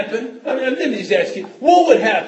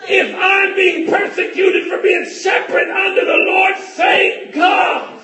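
loud, higher revival preaching; shouted holiness sermon; preaching at full force; 12 semitones higher, 4 dB louder, slower than usual. Acted. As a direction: hammer each line to the church.

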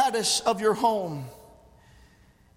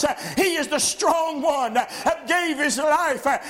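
fiery preaching; 7 semitones higher, 3 dB louder than usual. Real, not acted. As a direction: build to an impassioned pulpit shout. Preaches at full force.

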